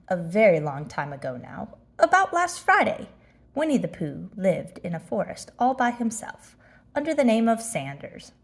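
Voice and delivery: ordinary delivery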